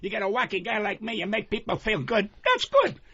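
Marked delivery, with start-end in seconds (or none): 2.4-3.0 excited burst. Real, not acted. Punchline burst of enthusiasm.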